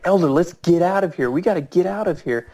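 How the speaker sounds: high, hurried alarm; urgent plea. Retold or imitated. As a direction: replay begging a companion to flee. Retold urgent plea to flee.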